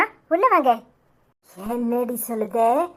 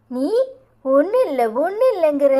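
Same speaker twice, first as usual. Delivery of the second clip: ordinary talk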